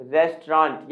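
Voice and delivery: "रेस्टोरेंट" as res-traunt, two syllables; corrected pronunciation. Shown as a demonstration. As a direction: squeeze "रेस्टोरेंट" into res-traant. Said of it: "क्या होता है दोस्तों?". ("रेस्टोरेंट" को 'रेस्ट्रॉन्ट' बोलें)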